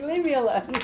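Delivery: mock-annoyed pet talking back; voicing the pet. Reader voicing her annoyed pet who wants to be left alone, playful mock voice. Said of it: "She's like".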